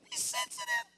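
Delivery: put-on very high, squeaky girlish coo; gushing mock-girl falsetto; swooning girlfriend. Mimicking a swooning girlfriend on a date.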